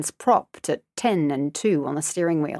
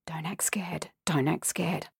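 much softer frantic whisper; panicked whisper; 9 dB quieter than usual. A narrator's reading. Girl tensing in the car, whispering in panic. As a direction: breathe it to yourself in fear.

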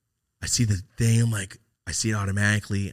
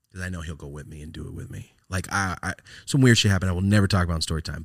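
ordinary talk, nothing marked

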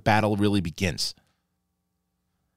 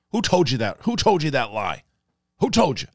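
higher-pitched insistent questioning; repeated pressing questions, raised pitch; mock interrogation; 7 semitones higher, 4 dB louder than usual. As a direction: demand an answer, lightly acted. Acting out the interrogation in the scene.

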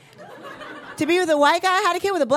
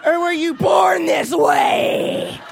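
mock shock; 3 semitones higher, much slower than usual. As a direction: draw out the fake astonishment. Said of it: "I was like".

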